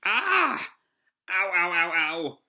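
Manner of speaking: high yelp sliding into ows; pained scream. Retold or imitated. Puppet hit by a flying screw, yelping in pain. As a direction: yelp as something hits you. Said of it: "who screams".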